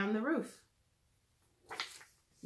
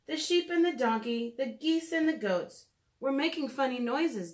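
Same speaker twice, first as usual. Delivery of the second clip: lilting storybook read-aloud; gentle storytime narration for little listeners; reading to children; 3 semitones higher than usual. Acted. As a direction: read it like a bedtime picture book. Reads picture book to children.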